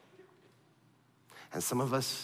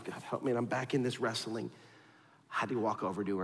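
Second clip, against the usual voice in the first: ordinary talk, nothing marked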